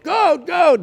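put-on high, loud childlike excitement; excited. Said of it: "he's equally excited about all of them".

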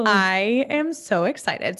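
ordinary delivery

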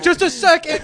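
very high, loud mock panic; panicked call. Caught kid calling to mom through door, mock panic. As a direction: shout through the door, stalling.

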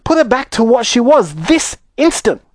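high, demanding mother; indignant yell. Acted. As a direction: demand it at once in an upset high voice. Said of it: "yelled Missus Beauregard".

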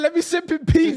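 high, loud put-on voice; hammed-up high voice of a friend; high mock quote. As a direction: squeak it like a pleading friend. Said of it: "He said".

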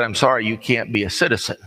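normal talking voice

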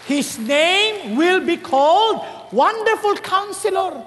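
slow, ringing Bible verse; solemn scripture proclamation. Acted. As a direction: proclaim each word slowly. (mabagal at marangal na pagpapahayag ng talata)

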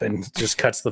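ordinary talk, nothing marked

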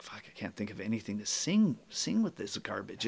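soft muttered complaint to himself; whispered thought. Retold or imitated. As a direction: grumble it under your breath.

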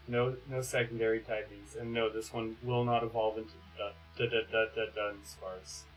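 normal talking voice

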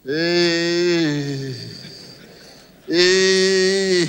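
a loud, drawn-out hey; loud exclamation. Real, not acted. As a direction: call out the hey across the hall. Preacher exclaiming.

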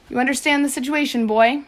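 loud shouted demand. A narrator's reading. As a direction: shout it across as a threatening question. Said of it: "Slank shouted".

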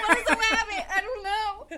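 high, loud bewildered cry; baffled outburst. Real, not acted. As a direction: yell in amused panic, totally stumped. Baffled, laughing game-show panic.

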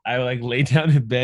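ordinary talk

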